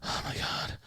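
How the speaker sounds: unvoiced, breathless gasp of dread; a whispered horrified gasp; gasped oh my God. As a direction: breathe it out in shock. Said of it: "And she's just".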